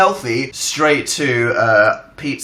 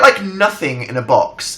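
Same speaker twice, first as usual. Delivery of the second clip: normal talking voice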